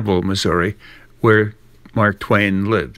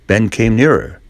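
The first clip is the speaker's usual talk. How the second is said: ordinary delivery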